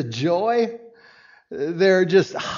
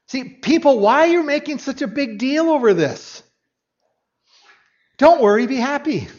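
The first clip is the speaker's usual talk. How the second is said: higher and louder, playfully dismissive; breezy Jesus; 7 semitones higher, 5 dB louder than usual. Pretend. Jesus to the mourners, breezy loud reassurance. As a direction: wave off the fuss with a grin. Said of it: "No, that's probably not what he said".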